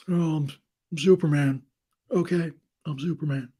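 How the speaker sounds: a clumsy Nick Cage playing Superman; awful Nick Cage. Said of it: "my awful Nick Cage impression".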